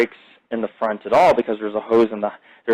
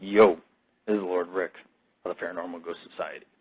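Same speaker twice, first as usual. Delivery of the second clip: movie star intro; 6 semitones lower, 8 dB quieter than usual. Pretend. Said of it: "being like".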